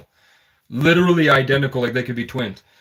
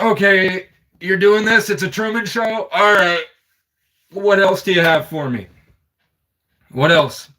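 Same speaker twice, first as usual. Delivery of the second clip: raised, worked-up retold challenge; higher-pitched, fed-up defiance; defiant challenge; 5 semitones higher than usual. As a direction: act out daring them to bring more. Acting out own defiant reaction.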